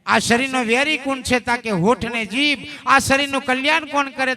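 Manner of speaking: the guru quizzes his listeners aloud; guru's question. Retold or imitated. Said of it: "ગુરુ મહારાજો બોલે". (ગુરુ મહારાજની ઊંચા અને મોટા અવાજે પ્રશ્નવાણી)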